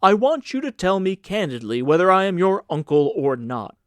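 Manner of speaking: demanding. A narrator's reading. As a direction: press the boy for a straight answer. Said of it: "cried the senator".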